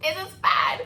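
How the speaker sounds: high, loud this is bad; alarmed outburst. Bride reacting to the receptionist's gasp, loud alarmed cry. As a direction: blurt it out in panic.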